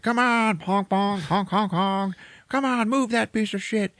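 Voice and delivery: high, strained mock road-rage yell; impatient driver. Pickup driver stuck behind them, mock impatient yell. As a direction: imitate honking and hollering from a car.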